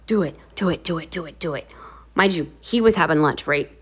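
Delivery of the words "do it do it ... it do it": low, soft, repeated egging-on; sneaky low prompting; hushed urging. Retold or imitated. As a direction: urge a friend on under your breath. Friend urging her under breath.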